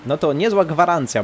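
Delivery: normal talking voice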